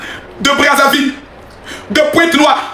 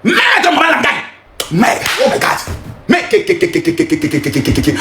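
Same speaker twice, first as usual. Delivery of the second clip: lower, trailing stammer; stunned stammer; 5 semitones lower than usual. Real, not acted. Fan stunned by the goal, stuttering disbelief. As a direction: sputter in disbelief.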